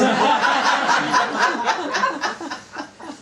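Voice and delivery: an open, hearty laugh at the joke; loud laugh. Real, not acted. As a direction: burst out laughing. Reader laughs after a line.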